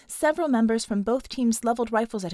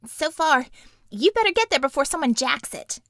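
higher, louder urgent warning; urgent character voice; 5 semitones higher, 4 dB louder than usual. Acted. Warning the team over comm, higher and urgent. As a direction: call out the danger fast and high.

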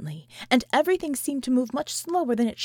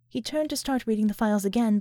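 ordinary delivery

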